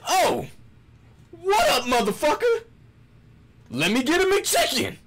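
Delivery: put-on greeting the cashier like an old friend, hyped. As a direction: burst in with crazy excitement. Acting out his greeting.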